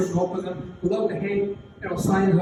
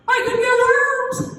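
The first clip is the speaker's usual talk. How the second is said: put-on horrified kid; 5 dB louder, slower than usual. He got this horrified look.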